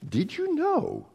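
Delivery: high, slow, drawn-out teasing question; teasing build. Acted. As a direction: stretch it out like a big tease. Preacher's dramatic teasing question.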